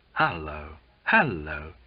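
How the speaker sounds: low, hushed-wonder exclamation; awestruck. A narrator's reading. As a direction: greet him with understated awe. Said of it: "There were awestruck exclamations".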